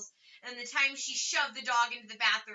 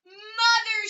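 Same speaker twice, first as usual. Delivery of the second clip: higher, louder horrified cry; 9 semitones higher, 9 dB louder than usual. A narrator's reading. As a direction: cry out in horror. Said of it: "she cried, horrified at what she saw".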